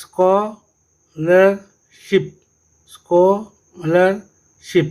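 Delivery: scholarship stretched out very slowly, sounding the sch; slow pronunciation drill. Shown as a demonstration. Teacher showing pupils a trigraph word, very slow drilling. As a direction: drag out scholarship so every sound is heard. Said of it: "और इसी तरह से I am going to apply for".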